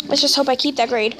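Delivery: ordinary talk, nothing marked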